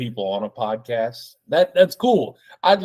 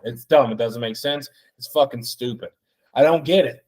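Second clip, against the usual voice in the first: ordinary delivery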